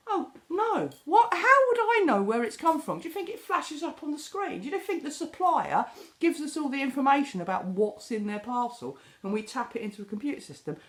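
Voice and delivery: hammed-up sarcastic retort to the customer; incredulous sarcastic outburst, high. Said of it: "You feel like saying that".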